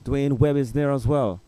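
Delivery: race caller. Acted. Live call of men's race.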